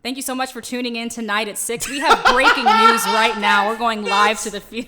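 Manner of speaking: loud, high, bright TV newsreader; news anchor voice. Shown as a demonstration. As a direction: announce it like breaking news at six. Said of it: "My news anchor voice?".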